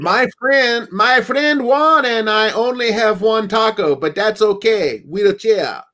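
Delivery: hammed-up Spanish accent, pitched high; bad Spanish accent. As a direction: put on a terrible Spanish accent for a pun. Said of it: "that is the worst Spanish accent ever".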